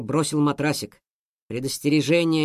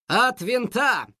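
triumphant; 7 semitones higher, much slower than usual. Acted. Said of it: "победно произнёс Мотя".

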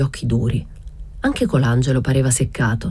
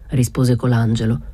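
plain reading voice

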